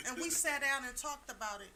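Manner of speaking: usual speaking voice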